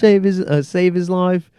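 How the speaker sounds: high, loud put-on British accent; hammed-up British accent impression; Patty Pimblett accent. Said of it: "you do good accents".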